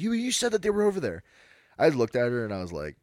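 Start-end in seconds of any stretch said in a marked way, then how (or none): 0.0-1.2 high, loud mock indignation; accusing customer. Shopper confronting clerk, imitated indignant accusation. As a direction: throw it back at the clerk, higher and louder.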